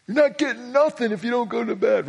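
a mock father's threat to the kids; dad warning. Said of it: "No, he wasn't that mean".

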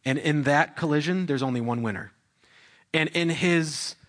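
ordinary delivery

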